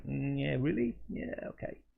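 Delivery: doubtful, rising then dropping away softly; retold scepticism. Hearing the stranger talk about Andy Garcia, mild scepticism. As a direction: humour someone you don't quite believe.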